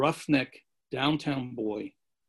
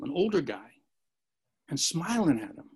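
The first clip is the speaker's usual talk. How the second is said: ordinary delivery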